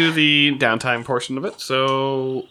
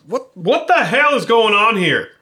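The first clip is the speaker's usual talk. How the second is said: high, loud indignant yell; outraged protest; 11 semitones higher, 7 dB louder than usual. Acted. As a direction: demand answers, outraged. Mal protests being restrained.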